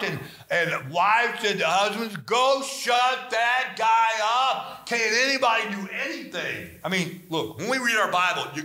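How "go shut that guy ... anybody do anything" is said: exasperated wife. Acted. Wives complain to husbands.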